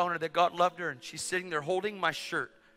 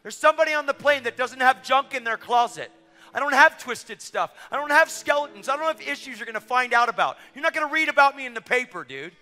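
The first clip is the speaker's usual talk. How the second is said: high, loud, driving sermon delivery; preaching at full force; 9 semitones higher, 7 dB louder than usual. Real, not acted. Preacher testifying of clean hearts, full-force preaching. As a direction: proclaim it to the whole congregation.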